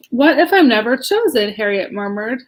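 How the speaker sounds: ordinary delivery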